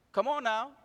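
ordinary delivery

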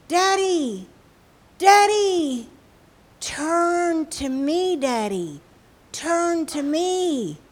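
high, loud, drawn-out wail; crying child. Acted. Scared little girl calling her father in the shelter, crying. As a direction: cry out for Daddy, stretching the words. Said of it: "she started to cry".

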